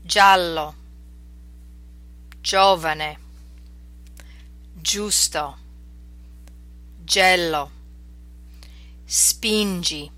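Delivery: "Giallo, giovane, giusto, gelo, spingi" with g like English J; word by word in careful Italian, falling tune on each; soft G Italian words. Shown as a demonstration. Teacher reading soft G examples, Italian pronunciation. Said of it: "sound combinations of soft G".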